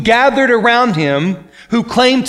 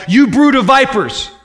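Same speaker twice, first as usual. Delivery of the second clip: higher, louder quoted denunciation; imitated harsh rebuke; 5 semitones higher, 3 dB louder than usual. Jesus rebuking scribes and Pharisees, imitated harsh denunciation. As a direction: hurl it as an acted rebuke. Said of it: "other things that are very difficult".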